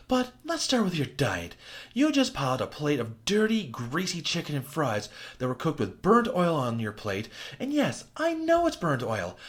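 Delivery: lightly voiced scornful woman; Alice scolding. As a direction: lay into him with disgust.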